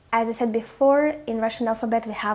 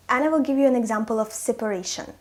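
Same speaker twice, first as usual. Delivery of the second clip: usual speaking voice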